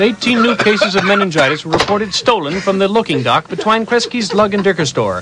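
crisp radio news read; news announcer. Acted. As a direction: read it like a bulletin. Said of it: "make it sound like a news announcement".

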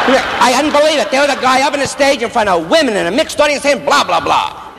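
high-pitched mock outrage; indignant witness. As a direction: testify high and scandalised, dropping on the blahs. Acting out indignant courtroom testimony.

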